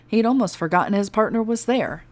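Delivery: plain reading voice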